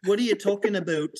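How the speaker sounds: put-on Canadian accent. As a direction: lean on a Canadian "about". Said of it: "I love Canadian accents".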